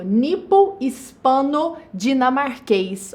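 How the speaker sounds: compound drawn out at half speed; careful teacher modelling each element; slow, segmented enunciation. Shown as a demonstration. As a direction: say it slowly, part by part.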